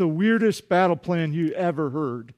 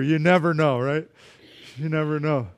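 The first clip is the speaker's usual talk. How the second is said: ordinary talk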